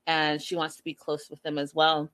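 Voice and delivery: ordinary delivery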